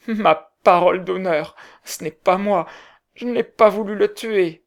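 high, loud, pleading cry; sobbing plea. A narrator's reading. Potter pleading his innocence to the crowd, high and tearful. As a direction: beg the crowd through tears. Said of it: "dit-il en sanglotant".

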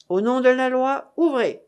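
loud official command; calling out. Acted. Soldiers demanding doors open at night, loud command. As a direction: bang on the door and command it.

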